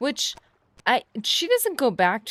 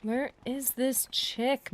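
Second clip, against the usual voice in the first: usual speaking voice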